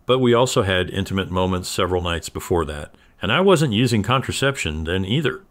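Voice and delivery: usual speaking voice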